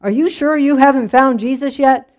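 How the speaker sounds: lightly hammed-up impatient preacher demanding an answer; a touch of harsh scolding. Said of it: "said in a harsh tone".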